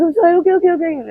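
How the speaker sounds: very high, loud, frantic appeasing; retold panicked apology. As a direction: re-enact placating an attacker in panic. Retells frantic apology under threat.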